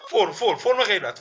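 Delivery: laughing. Real, not acted. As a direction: giggle through the repeated فرم. Laughing while naming the pose.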